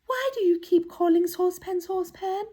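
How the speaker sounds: high-pitched doll voice; dainty doll. Acted. Doll questions the shouting children, high and curious. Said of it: "asked a beautiful dressed doll".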